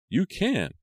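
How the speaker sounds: very high, pleading protest; crying out. A narrator's reading. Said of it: "Maya cried".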